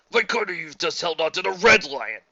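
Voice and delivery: usual speaking voice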